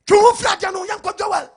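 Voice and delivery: high, loud call to the congregation; loud preacher shout. Acted. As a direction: shout it out over the crowd.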